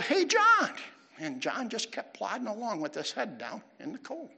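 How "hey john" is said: loud, high call across a street; imitated yell. Pastor calling to a man across the street, yelling. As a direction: call out to get his attention. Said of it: "he yelled".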